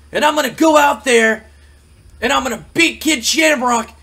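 loud, high-pitched wrestler's tirade; fired-up wrestling promo. Acted. Acts out fired-up wrestling interview promo.

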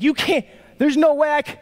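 high, broken-off mock panic; desperate plea. David panicking before God, acting desperate. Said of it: "the desperation in David's voice".

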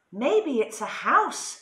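wondering cat. A narrator's reading. Cat guesses at the lights, slow wondering voice. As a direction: draw it out like a curious cat. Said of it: "said the cat".

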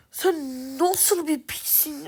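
slowly asking his teammate how he can be so bad, in disbelief; drawn-out disbelief. Real, not acted. Insulting teammate slower.

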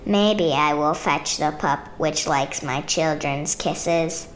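usual speaking voice

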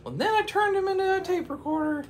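very high, loud falsetto; high falsetto character. Acted. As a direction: squeak it out matter-of-factly. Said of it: "She said".

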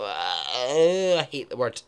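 slow, loud disgust. Real, not acted. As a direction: spit out your distaste for the word. Disgust at the word tits.

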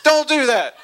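high, loud laughing don't-do-that; high laughing protest. Retold or imitated. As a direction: squeal it out, laughing. Scolding joking worker.

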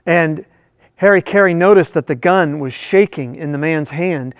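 ordinary talk

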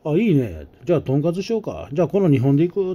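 pleased boss seizing the pork cutlet idea; excited boss. Retold or imitated. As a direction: jump on the idea like a delighted boss. Boss agreeing to tonkatsu.

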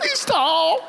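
high squeaky mock of a frightened boy; mocking the kid. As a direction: ham it up in falsetto. Relatives mocking scared kid.

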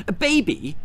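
high flighty trill; trilling fairy. Acted. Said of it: "trilled the flighty young Tooth Fairy".

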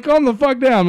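high, loud acted protest; retold alarm. Telling friends how they answered the coworker, acted alarm. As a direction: fend them off in mock panic.

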